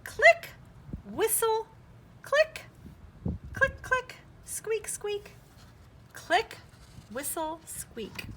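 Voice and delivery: high squeaky dolphin noises, click and whistle; dolphin clicks and squeaks. Shown as a demonstration. Teacher showing children a dolphin's clicks and squeaks. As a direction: chirp it out like a dolphin. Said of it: "Can you say that, friends?".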